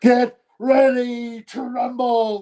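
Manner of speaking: high, drawn-out fight-night catchphrase; showing off a big announcer call; ring announcer. Shown as a demonstration. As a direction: belt it slowly like a boxing MC. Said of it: "It's so easy".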